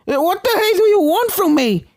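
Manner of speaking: high, loud cornered cry; terrified shout. A narrator's reading. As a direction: yell it in raw terror. Starlight cornered by the spirits.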